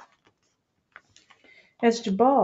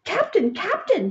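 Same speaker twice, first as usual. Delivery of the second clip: calling out; 9 semitones higher than usual. A narrator's reading. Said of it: "he called".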